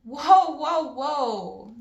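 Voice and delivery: loud, high whoa, settling down; dad cutting off his son's rant; startled whoa. A narrator's reading. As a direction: rein the kid in, half amused. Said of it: "looking both wounded and tickled".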